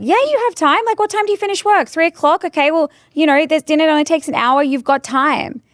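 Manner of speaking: high, fast, pushy voice; pushy salesperson. Retold or imitated. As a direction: push back, brushing off the excuse. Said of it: "people will be like".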